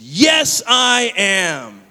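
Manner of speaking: high, booming shout, each word held out; acted full-voiced declaration; loudest profession of faith. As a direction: imitate a kid proudly proclaiming it to all. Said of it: "the loudest profession of faith I'd ever heard".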